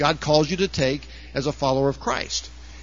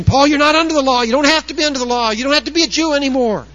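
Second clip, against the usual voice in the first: much higher, fast, hammed-up protest; mocking liberal critic; 8 semitones higher, 4 dB louder, faster than usual. Preacher imitating liberal Christians protesting to Paul, high and fast. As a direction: rattle it off fast like an indignant critic. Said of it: "I can just hear liberal Christians saying".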